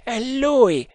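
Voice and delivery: high cry of recognition; startled cry. A narrator's reading. Indian spotting his enemy, high cry. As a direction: cry out high, without real shouting. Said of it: "gridò uno dei due indiani".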